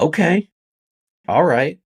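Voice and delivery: high, loud retold "well then" reaction; surprised acceptance. Reacts to news of bail posted.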